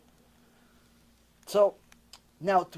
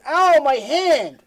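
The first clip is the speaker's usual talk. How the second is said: put-on slow, high, louder whimper of a hurt little boy; imitating crying toddler; 6 semitones higher, 9 dB louder, much slower than usual. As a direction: drag out the complaint like a two-year-old. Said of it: "He goes".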